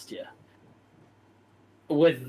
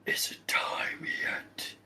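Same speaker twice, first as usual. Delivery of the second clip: slow, breathy whisper; a menacing, hissing question; dark lord whisper; 8 dB quieter, much slower than usual. Acted. Dark lord questions his servant in a slow whisper.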